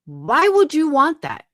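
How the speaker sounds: fed-up rhetorical shout; exasperated outburst. Real, not acted. Angry rant at a mother.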